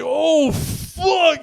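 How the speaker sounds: loud, high "oh fuck", long drawn out; high loud cry of dismay. Retold or imitated. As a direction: wail it as the trip hits. Trip returns, he reacts in dismay.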